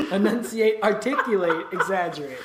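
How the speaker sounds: laughing through the words; giggling while rattling it off. Real, not acted. Cracking up mid warm-up.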